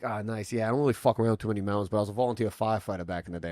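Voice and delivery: quick mock New York patter; New York accent. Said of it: "like, New York accent".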